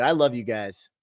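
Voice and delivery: ordinary talk, nothing marked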